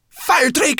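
high, loud cry of alarm; urgent high-pitched shout; alarmed call. A narrator's reading. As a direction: shriek the name in alarm. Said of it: "she called".

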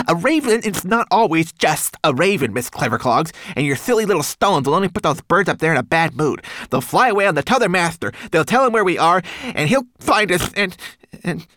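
high, loud, rushing tiny creature; frantic excitement. A narrator's reading. Homunculus warns ravens will tell master, frantic. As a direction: race on in agitation until words run out. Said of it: "flailing his arms around so excitedly".

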